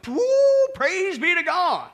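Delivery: high, loud, drawn-out praise; exultant whoop. Real, not acted. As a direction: whoop it out slowly and loudly. Exults that salvation is secure.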